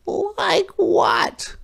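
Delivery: very high little fish voice; curious minnow. A narrator's reading. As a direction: ask eagerly as the tiny fish. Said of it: "asked the fish".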